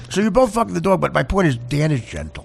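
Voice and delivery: ordinary delivery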